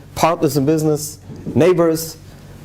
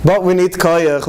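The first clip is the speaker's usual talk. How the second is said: slow and loud, leaning on each word; rally the room, slow and firm; emphatic call; 5 semitones higher, 4 dB louder, slower than usual. Real, not acted. Sermon turning point, emphatic.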